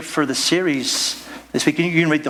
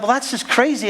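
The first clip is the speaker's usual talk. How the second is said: incredulous; 8 semitones higher than usual. Acted. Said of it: "you kind of like".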